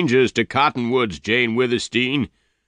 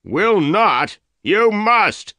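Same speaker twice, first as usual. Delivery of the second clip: loud, high, outraged shout; 12 semitones higher, 5 dB louder, much slower than usual. A narrator's reading. Dyer ordering Jane to answer, shouting. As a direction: bark the order at her. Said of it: "Dyer shouted".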